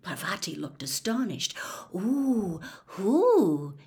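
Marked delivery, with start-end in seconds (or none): 1.9-3.8 nosy, drawn-out interest; keenly intrigued, voice lifted. A narrator's reading. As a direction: coo it with eager curiosity. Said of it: "she said keenly".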